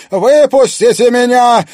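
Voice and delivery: very high, very loud scream; shrill shriek. Acted. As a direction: shriek it in fury. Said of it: "пронзительно завизжала Клариса".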